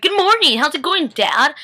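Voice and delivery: mock a chirpy hello to dad; sing-song greeting. Retelling Elena greeting Rick, a light chirpy mock voice. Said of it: "and he's like".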